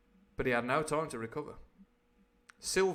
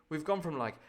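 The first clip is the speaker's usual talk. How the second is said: ordinary delivery, nothing marked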